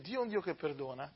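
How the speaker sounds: quiet, intimate Dio è un Dio che perdona; soft restatement. Shown as a demonstration. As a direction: say it almost to yourself. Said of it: "quiet restatement of the thesis".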